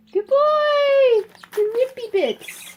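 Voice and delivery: loud, very high squeal of praise; excited pet praise. Real, not acted. Praising the bird for ripping, high excited cheer.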